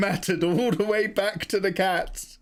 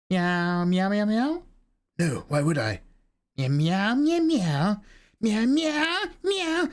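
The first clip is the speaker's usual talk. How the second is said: meows climbing higher with each line; a cat pleading with Sephiroth, meows rising higher; 5 semitones higher, slower than usual. Acted. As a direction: chatter like an insistent cat.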